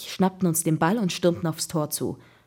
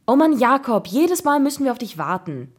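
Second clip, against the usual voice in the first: annoyed complaint; 6 semitones higher, 5 dB louder than usual. A narrator's reading. Boy greeting his late friend at the pitch, annoyed.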